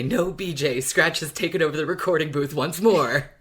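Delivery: mock-dramatic announcement; excited read-out. To the co-host, excitedly reading out her notes. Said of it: "My notes literally say".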